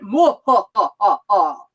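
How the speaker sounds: loud staccato villain laugh, falling in pitch; Dracula doll laugh. Retold or imitated. Mimicking a waiting-room toy, Dracula doll laugh. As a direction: mimic a toy vampire's cackle. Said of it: "this little Dracula doll ... he went".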